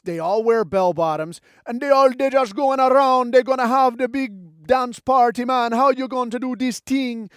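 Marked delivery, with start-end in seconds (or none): 1.6-7.3 high, loud mock Rasta patois; Rastafarian accent. Comic mocking Jar Jar's people, broad Rastafarian accent. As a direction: belt out a hammed-up Rastafarian accent. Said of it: "a race of Rastafarians or some kind of thing".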